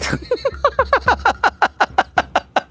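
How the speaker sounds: real laughter breaking out; high laugh. Real, not acted. Streamer laughing at own dinner-table story.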